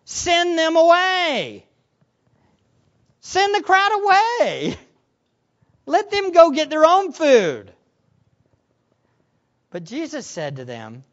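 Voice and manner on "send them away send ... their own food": high, loud, drawn-out order; commanding. Acted. Disciples giving commands to the Lord, bossy and commanding. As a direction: issue bossy orders at raised pitch. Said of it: "They give commands to the Lord".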